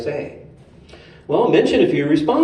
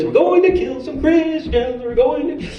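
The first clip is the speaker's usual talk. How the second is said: much higher, louder hammed-up eager hunter; eager Saul; 12 semitones higher, 4 dB louder than usual. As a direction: play Saul itching for the weekend. Voicing Saul eager to hunt.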